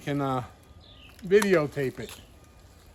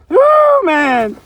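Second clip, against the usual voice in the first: excited whoop; 13 semitones higher, 8 dB louder than usual. Real, not acted. Excited at a big dig find.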